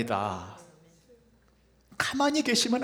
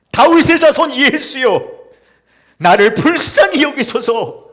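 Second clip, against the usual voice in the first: loud, higher pleading cry; 9 semitones higher, 11 dB louder than usual. Acted. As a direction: shout for mercy, pleading. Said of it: "외치기 시작했습니다". (큰 소리로 애원하며 외침)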